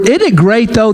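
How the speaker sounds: normal talking voice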